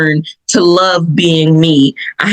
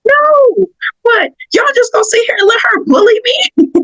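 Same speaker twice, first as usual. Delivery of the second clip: much higher, tearful half-shout; crying protest. Retold or imitated. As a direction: cry out against being bullied, pitched up. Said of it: "I'm like".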